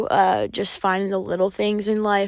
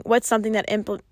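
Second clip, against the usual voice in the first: usual speaking voice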